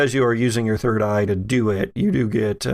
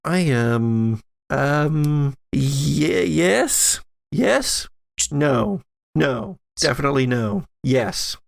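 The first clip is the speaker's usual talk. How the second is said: flustered, halting self-contradiction; Joyce; slower than usual. Acted. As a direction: waver between answers, rising questioningly then correcting. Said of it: "And she says".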